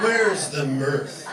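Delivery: normal talking voice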